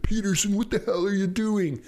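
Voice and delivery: imitating the coach's high, exasperated yell; coach freaking out. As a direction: play the coach losing it at a lost receiver. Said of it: "the coach is freaking out".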